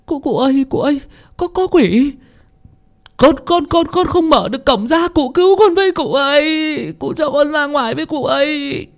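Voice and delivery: terrified pleading. Acted. Frightened girl begging her master for rescue. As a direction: stutter and beg to be let out. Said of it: "Cô Thơm khiếp sợ".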